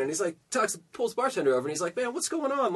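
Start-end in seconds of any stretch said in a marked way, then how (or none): 2.0-2.7 high, hushed mock freak-out; losing it. Rattled bar patron pulls the bartender over, losing it. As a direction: plead in a soft, high, rattled voice. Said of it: "So he starts losing it".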